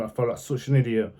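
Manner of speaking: usual speaking voice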